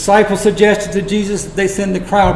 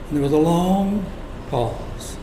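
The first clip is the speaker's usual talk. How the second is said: hushed suspense; 7 dB quieter than usual. A narrator's reading. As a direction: drop away softly on "pause". Acting out the awkward silence.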